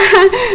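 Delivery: a short derisive giggle; real laugh. Real, not acted. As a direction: laugh at her, mockingly. Mocking rival's lisp.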